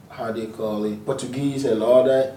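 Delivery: ordinary talk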